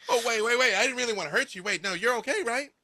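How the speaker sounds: put-on high, urgent kid voice; worried child. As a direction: panic like a child who hurt someone. Child panics at having hurt someone.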